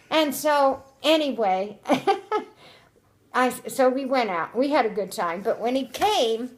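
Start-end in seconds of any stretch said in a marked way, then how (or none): 1.8-2.4 quick high chuckle; a brief real laugh; light amused giggle; short laugh. Real, not acted. Laughs while telling story.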